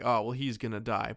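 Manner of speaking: ordinary delivery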